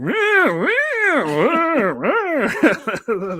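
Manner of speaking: high, loud swooping mock singer noises. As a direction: wail wordlessly in rising and falling swoops. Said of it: "My [name] impersonation would be".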